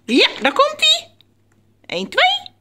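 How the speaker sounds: high-pitched countdown to a pet; thrilled high squeal; excited encouragement. Real, not acted. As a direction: egg the bird on in a squeaky voice. Cheering the bird on.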